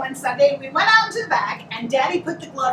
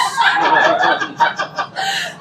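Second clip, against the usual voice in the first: high burst of laughter. Real, not acted. Wife laughing while telling the guests the boxing story, high laughter. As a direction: crack up at the memory.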